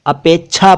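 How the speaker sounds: अपेक्षा as "apechchha", the ksh turned into chh; mispronounced chh. Shown as a demonstration. Quoting how some people read it, अपेक्षा wrongly said "apechchha". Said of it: "वो उच्चारण गलत है".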